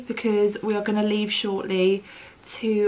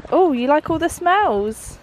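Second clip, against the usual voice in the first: higher, brighter dog-talk; 7 semitones higher, 4 dB louder than usual. Real, not acted. Owner asking Teddy about the smells, high doggy voice. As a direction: coo at the pup sniffing around.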